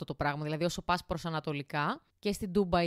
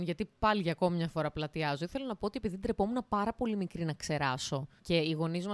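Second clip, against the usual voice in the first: usual speaking voice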